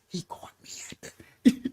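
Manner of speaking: full unvoiced whisper. Girlfriend whispering to coworker that he caught her, whisper. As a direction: breathe it out in secret.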